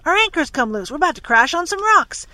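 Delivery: loud high warning yell; alarmed shout. A narrator's reading. Don warning the crew about the rocks, loud alarmed shout. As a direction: shout the danger over the wind.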